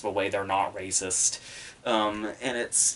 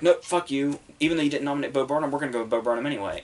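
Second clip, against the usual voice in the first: quick, higher, cocky brush-off; mock defiant guild; 4 semitones higher, faster than usual. Mimicking the Writers Guild snubbing predictions, fast and smug. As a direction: rattle it off like a smug voter brushing you off.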